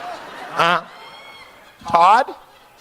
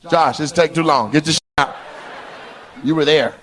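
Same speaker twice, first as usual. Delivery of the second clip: usual speaking voice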